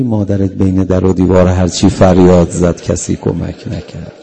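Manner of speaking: lamenting cry, swelling. Real, not acted. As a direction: cry it out as a mourning call. Preacher's mournful climax. (روضه با صدای بلند و سوزناک)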